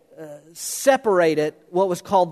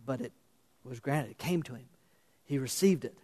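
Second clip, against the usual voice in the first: hushed reverence; 5 semitones lower, 12 dB quieter than usual. Real, not acted. Hushed climax of the sermon.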